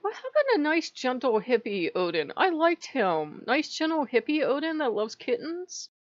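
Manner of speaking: mock wistful high whine; lightly voiced pleading; high plaintive complaint. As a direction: whine about the lost gentle god. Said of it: "I'm like".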